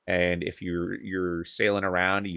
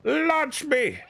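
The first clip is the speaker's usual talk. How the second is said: high, hammed-up battle cry. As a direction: yell it like a charging soldier. Launching character in game.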